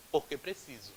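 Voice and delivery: porque clipped and soft; fast, quiet porque; quick answer. Shown as a demonstration. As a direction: rattle off the answer. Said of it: "um porquê que sai mais rapidinho".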